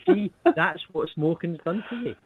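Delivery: mock preachy taunt. Teasing friend about smoking's effect.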